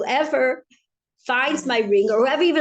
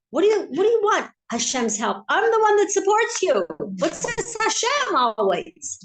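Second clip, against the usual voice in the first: high, exasperated mock tirade; acted indignant boss; upset landowner; 9 semitones higher than usual. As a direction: challenge him, pitched way up. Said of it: "the Paritz is upset at him".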